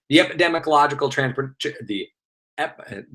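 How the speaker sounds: tripping over a long term, breaking off; tongue-tied. Real, not acted. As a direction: stumble on "epidemiological transpor-" and give up, trailing into fragments. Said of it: "say it three times fast".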